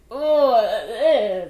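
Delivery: a loud, real "ugh" of revulsion; disgusted groan. Real, not acted. Cook recoiling from rubbery eggs, loud disgusted "ugh".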